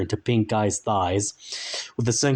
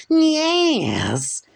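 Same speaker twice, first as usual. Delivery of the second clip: very high strained squeal; salamander creature voice; 5 dB louder than usual. A narrator's reading. The salamander answers Frank, squealing high. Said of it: "he cried".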